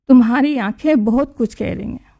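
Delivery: plain reading voice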